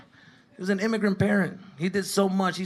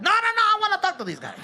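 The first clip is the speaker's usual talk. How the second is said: much higher insistent dad voice; 3 dB louder than usual. Retold or imitated. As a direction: push past your wife to get at the guys.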